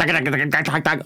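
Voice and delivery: rapid-fire mechanical chatter; staccato run of identical syllables; rattled-off "dak" noises. Retold or imitated. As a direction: imitate mechanically churning through a routine. Imitates rattling through a set.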